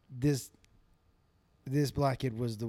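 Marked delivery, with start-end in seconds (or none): none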